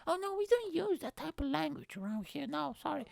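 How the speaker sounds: very high-pitched squeaky prude imitation; exaggerated prissy mockery. Religious people objecting to language, mocked in squeaky voice. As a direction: ham up the prim objection, way up high. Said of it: "That's what they always say".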